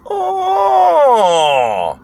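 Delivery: long high wail, sliding down; imitated old man's high, drawn-out "oh"; old man's outburst. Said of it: "And he goes".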